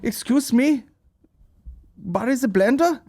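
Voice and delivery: high mock-German accent; imagined German fan. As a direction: accost someone as an eager German stranger. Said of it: "imagine I was leaving a gig and some guy's like".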